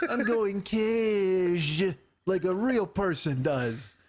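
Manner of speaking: very slow, drawn-out, cracking up; Dice impression laughing. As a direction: drag the words out while laughing. Imitating Dice again, laughing.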